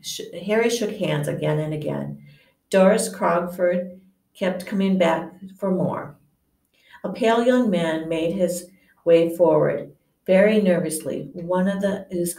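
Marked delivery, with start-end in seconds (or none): none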